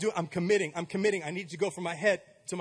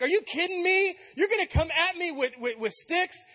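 mocking giant; 9 semitones higher than usual. A giant taunting young David, higher and scornful. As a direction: sneer down at a little kid like a giant. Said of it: "the giant's like".

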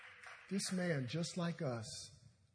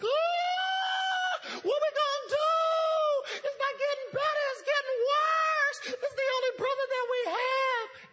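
hammed-up high, loud panic wail; panicked shriek; 7 dB louder, slower than usual. Mary panics to her sister about their brother, hammed-up shrieking. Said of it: "Mary is having a panic attack".

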